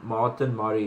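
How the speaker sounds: ordinary delivery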